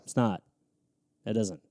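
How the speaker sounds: ordinary delivery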